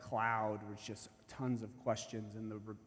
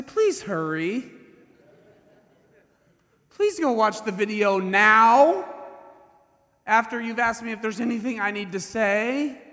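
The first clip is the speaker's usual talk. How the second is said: desperate plea; 11 semitones higher, 14 dB louder than usual. Retold or imitated. Accused coach begging the detective to watch the video. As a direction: beg them to hurry, almost shouting.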